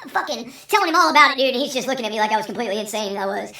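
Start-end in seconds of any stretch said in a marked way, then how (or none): none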